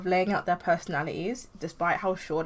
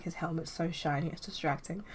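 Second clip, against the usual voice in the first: couldn't care less; bored mumble; 8 dB quieter than usual. Acted. Lazy Chen answers.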